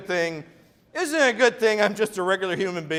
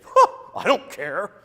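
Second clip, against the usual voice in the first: dismissive scoff; 3 semitones higher, 6 dB louder than usual. Retold or imitated. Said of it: "they'd laugh at you and say".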